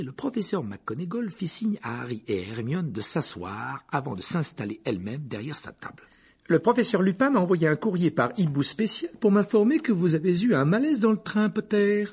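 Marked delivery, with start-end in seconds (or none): 6.5-12.0 high, loud, formal schoolmistress; strict professor. A narrator's reading. Professor questioning a pupil in her office, high and formal. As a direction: address the pupil firmly in a raised, high voice. Narrator voices McGonagall.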